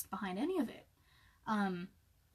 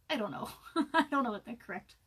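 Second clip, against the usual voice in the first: usual speaking voice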